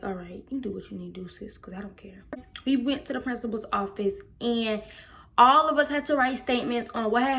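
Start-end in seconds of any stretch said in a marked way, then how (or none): 0.0-2.2 soft, fast brush-off whisper; dismissive whisper. Retold or imitated. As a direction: wave her off under your breath. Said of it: "I was just like".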